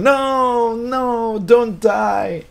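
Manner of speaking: high, drawn-out shout of desperate pleading; reenacted shouting. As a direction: cry out "no" as a fan watching heroes die. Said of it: "I was, like, shouting".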